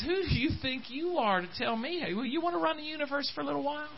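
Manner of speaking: a teasing divine rebuke, hushed and quick; voice of God to Job. Retold or imitated. Preacher voicing God answering Job, soft teasing challenge. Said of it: "God ... says".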